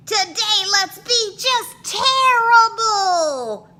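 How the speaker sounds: put-on loud, very high giddy kid voice; mischievous kids. A narrator's reading. Kids deciding to misbehave, giddy loud voice. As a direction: relish the naughtiness.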